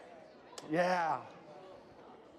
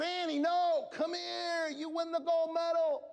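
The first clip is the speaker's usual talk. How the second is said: higher call across the track; 11 semitones higher, slower than usual. Retold or imitated. Coach calling to his runner at the finish, raised voice. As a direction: call the runner back to the line. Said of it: "And I said".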